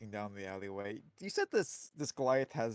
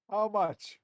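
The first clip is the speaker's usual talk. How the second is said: character voice; 9 semitones higher, 7 dB louder than usual. Acted. Modron character asks.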